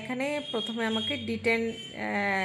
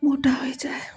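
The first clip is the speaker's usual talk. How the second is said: whispering; 4 semitones higher than usual. Real, not acted. Wistful aside about weight gain.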